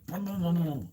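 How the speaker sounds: low rumbling booms; rolling run of deep booms; imitated thunder. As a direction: imitate heavy thudding footsteps overhead. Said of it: "he sounds like Thunder up there".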